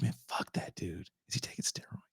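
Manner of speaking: whispered, envious aside; hushed aside. Retold or imitated. Jealous viewer whispers at the TV, hushed. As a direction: mutter it under your breath. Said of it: "I was like".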